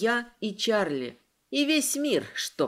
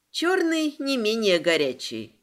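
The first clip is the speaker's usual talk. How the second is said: ordinary delivery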